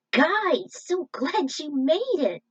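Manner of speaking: excited greeting. Acted. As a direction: Doc overjoyed to see the troopers. Scientist greets returning heroes.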